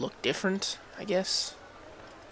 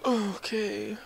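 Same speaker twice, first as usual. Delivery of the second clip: high, whiny fake-crying complaint; hammed-up sob over climbing back up; mock whining. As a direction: whimper like a hammed-up sulking child. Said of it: "This is so annoying".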